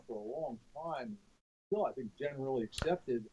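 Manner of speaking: distant, muffled line; bad phone connection; echoing tunnel. Real, not acted. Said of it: "it's like you're talking through a tunnel".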